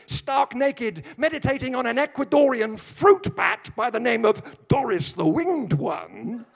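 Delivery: high, loud build through the absurd list, slowing on Doris the Winged One; loud rising sermon riff; full-force preaching. Acted. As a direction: preach it up to the punchline. Preaching run, raised and loud.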